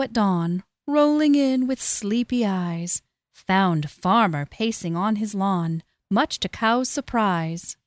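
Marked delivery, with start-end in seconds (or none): none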